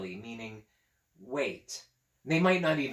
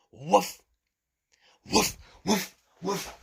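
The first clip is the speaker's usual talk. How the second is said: 1 barking; 4 semitones higher, 4 dB louder, slower than usual. Pretend. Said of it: "pretending to be a dog".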